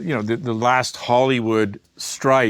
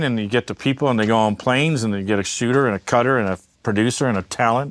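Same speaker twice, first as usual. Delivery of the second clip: ordinary delivery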